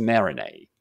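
"marinade" ending on "nay", no D; silent D. Shown as a demonstration. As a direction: leave off the last D in "marinade". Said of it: "読まないことにしましょ".

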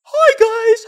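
very high, loud put-on greeting; mock approved YouTuber. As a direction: greet in a loud mocking falsetto. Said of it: "this is what they want".

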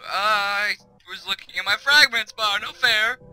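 loud, high cry of complaint; indignant protest. Real, not acted. Player killed while looking away, protesting loudly. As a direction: whine loudly about being cheated.

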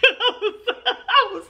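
laughing through the words; real laugh mid-sentence. Real, not acted. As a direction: giggle as it comes out.

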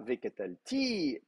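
"V catal, ti" softly, with TI lifted high; "V catal, ti" accented on the last syllable; stress on final TI. Shown as a demonstration. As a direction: go light on "V catal" and raise "ti". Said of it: "Donc si vous voyez".

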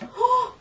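sharp gasp. Real, not acted. Surprised gasp at quilt.

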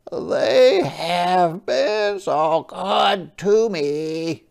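high, slow, gentle crab voice; a small polite sea creature asking; Hermit Crab voice. Acted. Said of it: "thought Hermit Crab".

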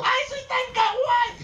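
very high slow kawaii squeal; cute anime girl. Retold or imitated. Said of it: "Y me has dicho".

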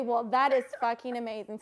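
ordinary talk, nothing marked

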